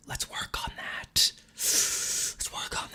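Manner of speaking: slow, breathy whisper; slow conspiratorial whisper. Real, not acted. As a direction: drop to a whisper, like a secret. Hushed aside after grand speech.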